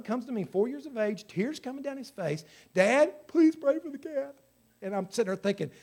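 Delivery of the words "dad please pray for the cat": put-on lightly higher child's plea; a hint of a tearful little boy asking dad; voicing his little son. Voicing his crying four-year-old son.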